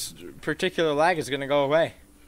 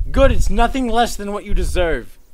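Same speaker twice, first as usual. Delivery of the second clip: high, loud outraged retort; indignant shout at a game character; loud indignant yell; 6 semitones higher, 9 dB louder than usual. Real, not acted. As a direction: yell back at the customer, offended. Yelling at game customer.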